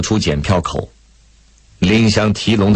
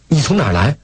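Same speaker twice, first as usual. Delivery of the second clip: higher, concerned asking; hurried question; 6 semitones higher, faster than usual. A narrator's reading. Lei Feng rushing up to the distressed woman, hurried question. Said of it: "急忙走上前去问大嫂子".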